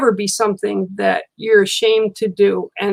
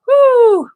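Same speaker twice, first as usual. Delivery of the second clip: a loud, high whoosh of breath; whew; 8 dB louder than usual. Real, not acted. Reacting to Cindy's comment with a loud high whew. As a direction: let out a big high whew, overwhelmed.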